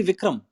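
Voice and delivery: "Vikram" ending in m, not nt; mispronounced first name. Retold or imitated. Actor quoting people's wrong first name, ending in m. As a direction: say "Vikram" with a closing m in place of Vikrant. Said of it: "can't even get my first name".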